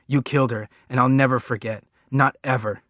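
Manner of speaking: loud desperate screamed accusation; boy screaming at his father to stop him. Acted. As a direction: scream it over and over. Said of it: "keep screaming".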